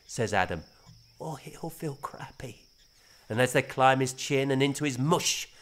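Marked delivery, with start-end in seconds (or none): 1.2-2.6 slow breathy whisper; whispered aside. A narrator's reading. Grandfather whispering to father, slow whisper. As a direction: whisper it behind the boy's back.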